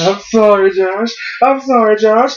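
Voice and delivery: high, mock-sweet sorry, Josh; sarcastically sing-song the apology like a polite monster; mock Kane apology.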